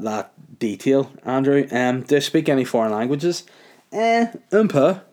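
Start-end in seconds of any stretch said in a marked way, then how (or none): 3.9-5.0 high, drawn-out French aside; slow, high-pitched bit of French; French phrase. Pretend. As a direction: toss in French, high and loud. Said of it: "as they say in France".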